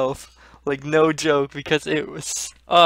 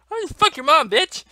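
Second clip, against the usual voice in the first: high, loud, scornful outburst; exasperated high-pitched retort; angry comeback; 12 semitones higher, 6 dB louder than usual. Real, not acted. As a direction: snap back at the naysayers, voice pitched up. Angry comeback to imagined advice.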